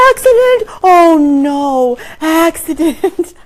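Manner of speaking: high, loud mock alarm; imitation of a small boy raising the alarm; toddler shouting. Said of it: "points to it and shouts".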